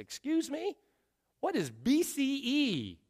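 high, soft incredulous question; mock incredulity. Dad questions his daughter's school lesson, incredulous. Father reacts to daughter's correction.

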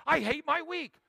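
imitating a purposeless person, loud and bitter; mock despair. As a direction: sneer out the week's misery. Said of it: "people who exist with no purpose".

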